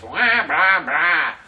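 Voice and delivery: mock empty chatter; scornful imitation of empty talk; dismissive blathering. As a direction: rattle off hollow blahs, rising. Mocks directors who only say it.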